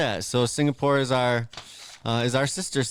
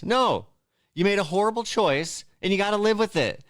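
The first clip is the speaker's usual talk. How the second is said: mock scolding; 7 semitones higher than usual. Scolding stranded astronauts.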